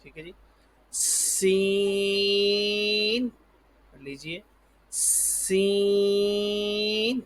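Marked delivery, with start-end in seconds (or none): 0.9-3.3 سین with a long hissed s and stretched vowel; whistling seen. Shown as a demonstration. Teacher shows second whistle letter, long sibilant سین. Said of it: "حروف صفیریہ میں سے دوسرا حرف". 4.9-7.2 سین with a long hissed s and stretched vowel; whistling seen. Shown as a demonstration. Teacher shows second whistle letter, long sibilant سین. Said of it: "حروف صفیریہ میں سے دوسرا حرف".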